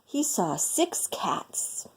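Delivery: soft slow storyteller whisper; hushed whisper. A narrator's reading. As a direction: whisper it slowly, like peeking in secret. Peeking secretly into the house.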